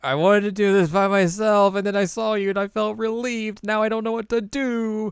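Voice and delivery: high, plaintive little voice; put-on helpless pleading; insecure confession. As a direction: pour out self-doubt in a high whine. Acts Chisato's insecure confession.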